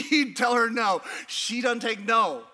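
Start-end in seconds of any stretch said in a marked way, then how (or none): none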